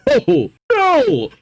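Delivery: high, wailing refusal; appalled outcry. Real, not acted. Reader reacting to Gabriel's letter, appalled outcry. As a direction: cry out no in shocked protest.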